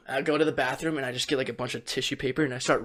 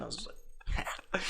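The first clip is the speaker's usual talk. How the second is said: whisper; 4 semitones lower, 18 dB quieter, much slower than usual. Retold or imitated. Whispered end of the story.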